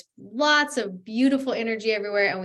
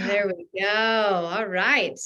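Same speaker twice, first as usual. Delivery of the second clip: ordinary talk, nothing marked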